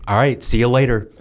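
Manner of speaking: offhand dismissal; breezy goodbye. Retold or imitated. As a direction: toss it off and walk away. Jocko dismissive farewell.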